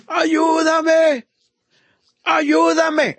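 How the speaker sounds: high, drawn-out pleading cry; preacher acting a desperate plea; calling out for help. Acting the woman crying out to God.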